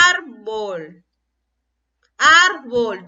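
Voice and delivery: "Árbol" split as ÁR-bol, first syllable punched; stressed first syllable. Shown as a demonstration. Teacher says listen where it is stronger, "Árbol" with stressed first syllable. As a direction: separate the syllables and lean on the "Ár" of "Árbol".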